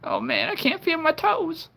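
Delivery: high, breaking crying voice. Pretend. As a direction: sob it out like a hurt child. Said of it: "It's my crying voice".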